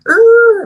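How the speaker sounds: very high, loud yell of rage; angry roar. Retold or imitated. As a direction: let out a furious growl as you leave. Dad storming off in rage.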